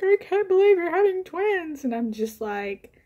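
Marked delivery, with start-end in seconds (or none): 0.0-1.8 much higher and louder, bubbling with delight; thrilled. Retold or imitated. Doctor delighted by the twin news, excited high exclaim. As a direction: imitate a doctor who is over the moon. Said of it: "my doctor was, like, thrilled".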